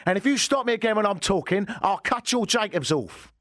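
host the radio show as a threatening East End gangster; angry East End London menace announcing the station; angry Bricktop. Retold or imitated. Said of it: "You have to be very angry".

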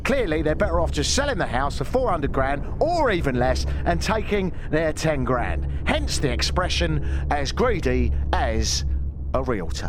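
menacing Cockney gangster explains realtors over ominous music; Bricktop impression. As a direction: lecture like a menacing Cockney hard man. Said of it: "maybe I should do a bit of Bricktop".

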